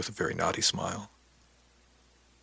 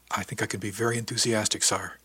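ordinary delivery

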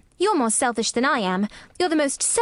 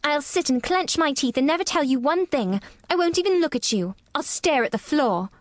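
put-on higher, defiant child voice; fierce; 6 semitones higher than usual. A narrator's reading. Girl vows never to talk to sick boy, fiercely stubborn. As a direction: obstinate little girl digging in. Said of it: "said Mary fiercely".

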